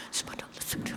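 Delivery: whispered subhanallah, out of air; rushed zikir running out of breath; breathless whisper. Shown as a demonstration. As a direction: gasp it out with no breath left. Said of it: "jangan sampai tak cukup nafas".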